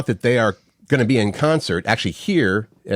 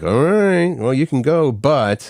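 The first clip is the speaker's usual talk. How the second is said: mock-reluctant wife, pitched up then settling; drawn-out, higher "all right", lightly acted; grudging permission; 5 semitones higher, slower than usual. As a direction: imitate a wife giving in with strings attached. Voices wife's conditional permission.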